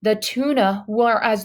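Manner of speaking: ordinary delivery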